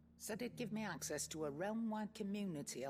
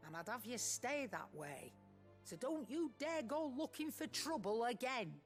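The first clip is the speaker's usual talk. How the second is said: sharp, raised warning; stern scolding; 7 semitones higher than usual. Acted. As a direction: tell him off firmly. Nan scolds Goots sternly.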